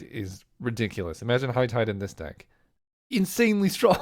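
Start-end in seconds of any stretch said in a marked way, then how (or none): 3.1-4.0 laughing through the words. Real, not acted. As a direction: break into a laugh mid-phrase. Laughs mid-word at High Tide.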